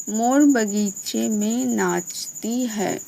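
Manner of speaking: slow, drawn-out stress in मोर बगीचे में नाचती है; weighted stresses. Shown as a demonstration. Teacher reading an example sentence, slowly with weighted stresses. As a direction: lean long on each word of मोर बगीचे में नाचती है. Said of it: "देखो इसमें भी बल लग रहा है".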